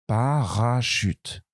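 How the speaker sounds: "Parachute" slowly spaced into syllables; slow dictation. Shown as a demonstration. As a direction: spell out "Parachute" by ear. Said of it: "je vais donner parachute". ("Parachute" très lentement, syllabe par syllabe)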